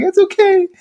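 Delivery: high-pitched mock forced okay; strained reassurance. Hurt woman brushing off his apology, strained high voice. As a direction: imitate someone anxious to be left alone.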